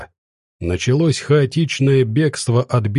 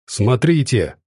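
ordinary delivery

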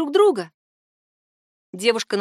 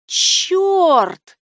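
loud, high, drawn-out curse; startled exclamation; 9 semitones higher, 7 dB louder than usual. A narrator's reading. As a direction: exclaim in sudden realisation. Franz suddenly grasps what it is.

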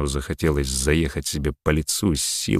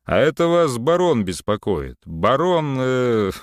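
high, loud pompous fool; clowning; 8 semitones higher, 3 dB louder than usual. A narrator's reading. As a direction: ham up a silly baron. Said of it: "дурашливо сказал в трубку Самарин".

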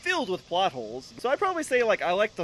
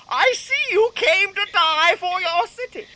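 high, slow, louder repeat; put-on high villain voice, pressing the point; insistent villain; 11 semitones higher, 5 dB louder, slower than usual. Villain repeats himself to Batman, high and insistent.

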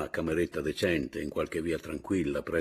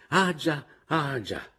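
high, exclaimed realisation, said twice; suddenly remember, voice jumping up; absent-minded old man; 7 semitones higher, slower than usual. Acted. Father shuffling off after daughter's reminder, absent-minded exclamation.

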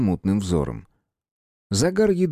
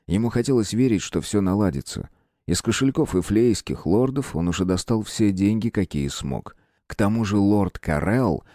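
ordinary delivery

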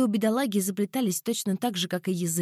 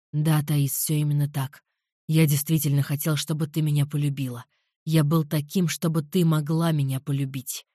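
lower, darker man's voice; low male voice; 6 semitones lower than usual. A narrator's reading. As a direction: voice him low and calm. Alvir's confession.

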